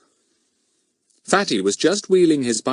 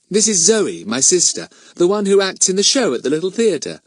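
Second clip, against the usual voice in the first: high, cheerful introducing voice; Missus Thomas, friendly hostess; 10 semitones higher, 4 dB louder than usual. A narrator's reading. Woman introduces her actress sister to two girls, friendly. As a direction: present your sister proudly to guests.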